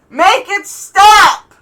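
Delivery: very slow, high, loud shout; yelling back. Acted. Ethan yelling back at Caspian from the other room, loud slow shout. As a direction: drag out a desperate yell. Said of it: "you hear Ethan yell back".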